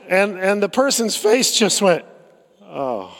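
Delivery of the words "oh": soft, dropped little oh; the moment it dawns on them; deflated oh. Retold or imitated. Leader realizes mistake.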